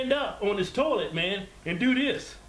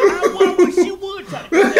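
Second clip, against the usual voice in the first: laughing mock threat; 11 semitones higher, 9 dB louder than usual.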